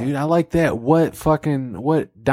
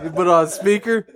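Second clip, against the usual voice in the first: ordinary delivery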